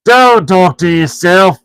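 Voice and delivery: slow, high troll rebuke; troll Tom scolding Bert; grumpy troll. A narrator's reading. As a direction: snap at him like a troll. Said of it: "said Tom".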